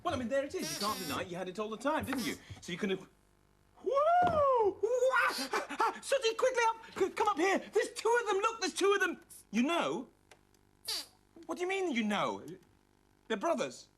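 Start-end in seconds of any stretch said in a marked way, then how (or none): 3.8-5.3 high, loud cries of astonishment; startled, high-pitched double yelp; shocked yelps. Acted. Shock at seeing two dogs. 6.0-9.2 high, urgent, astonished cries; excited alarm, pitched high throughout; astonished calling out. Acted. Alarmed calling Sooty to see.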